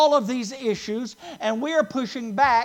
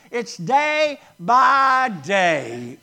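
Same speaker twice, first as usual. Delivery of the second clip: slow, louder "day by day" with each word hit; stress on day by day; 5 dB louder, much slower than usual. Shown as a demonstration. Preacher giving the emphasis to his congregation. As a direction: spell out "day by day" slowly. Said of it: "Here's the emphasis".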